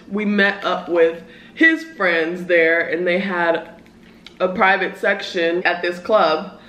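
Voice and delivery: usual speaking voice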